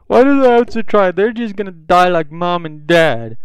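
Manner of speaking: put-on high, loud upset child's outburst; imitated crying kid. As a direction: act out a kid's distressed wail. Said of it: "he's just sitting there crying".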